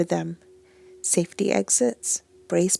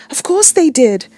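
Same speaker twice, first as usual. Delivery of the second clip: rising panicked pitch, loud; hysterical; 9 dB louder than usual. A narrator's reading. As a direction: tip into hysteria, voice climbing. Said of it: "I can hear the hysteria in my voice".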